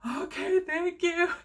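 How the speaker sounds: high, teary thank-you; choked-up gratitude; tearful thanks. Retold or imitated. Thanking the race director, high and tearful. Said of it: "sees me over here crying".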